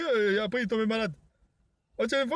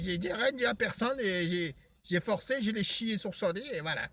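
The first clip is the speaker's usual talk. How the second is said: ordinary talk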